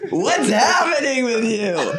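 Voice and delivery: high, drawn-out impression with a little twang; Christopher Walken. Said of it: "That was my Christopher Walken right there".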